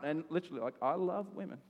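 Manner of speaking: usual speaking voice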